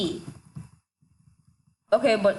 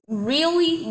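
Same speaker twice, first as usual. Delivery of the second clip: incredulous really; 8 semitones higher than usual. Real, not acted. Repeats in disbelief.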